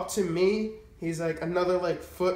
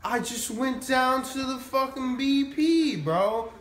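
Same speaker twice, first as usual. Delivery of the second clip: dopey friend; 8 semitones higher than usual. Retold or imitated. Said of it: "He's just like".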